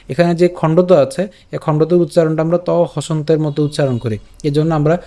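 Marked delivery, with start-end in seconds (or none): none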